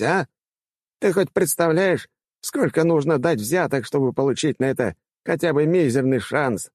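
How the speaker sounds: high-pitched, worked-up rector; exclaiming old man. A narrator's reading. Rector reminding orphan student of his debt, exclaiming. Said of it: "воскликнул старик".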